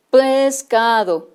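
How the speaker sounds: pescado stretched very slowly, sound by sound; slow sounded-out word. Shown as a demonstration. Teacher shows how a child blends the sounds, slowly. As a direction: draw out pescado slowly so each sound is heard. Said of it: "tengo que decir cómo las ordeno".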